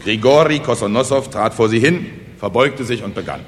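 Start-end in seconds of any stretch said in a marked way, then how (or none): none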